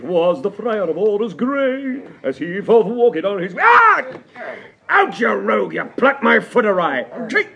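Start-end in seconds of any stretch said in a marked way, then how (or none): 3.6-4.0 high sharp yelp of pain. Acted. Master yelping at the servant who plucked his foot. 7.3-7.5 angry strike. Acted. Striking the clumsy servant.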